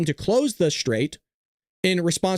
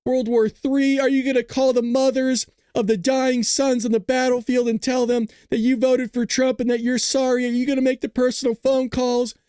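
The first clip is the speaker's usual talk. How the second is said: hysterical leftist; 5 semitones higher than usual. Pretend. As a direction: whine it like a panicking pundit, pitched up. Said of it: "the hysterics of the left go".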